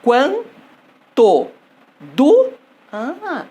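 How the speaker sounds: "Quanto, do" split into loud, high beats; syllable by syllable. Shown as a demonstration. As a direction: punch out each syllable of "Quanto, do" with gaps. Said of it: "Vamos lá dividir". ("Quanto, do" sílaba por sílaba, com força)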